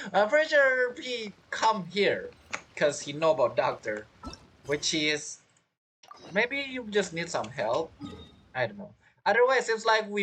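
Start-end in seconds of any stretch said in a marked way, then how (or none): none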